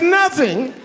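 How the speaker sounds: husband high and stunned that the box was empty; disbelieving yelp. Retold or imitated. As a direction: squeak it in shock.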